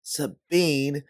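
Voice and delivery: high, loud shout after a runaway friend; calling after a friend who runs off, frustrated yell; frustrated scream. Acted. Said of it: "you scream in frustration".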